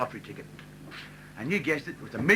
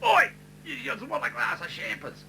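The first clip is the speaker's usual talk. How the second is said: cheeky ocker bloke; 9 semitones higher than usual. Retold or imitated. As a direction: call out like a flash larrikin showing off. Winner chatting up girl.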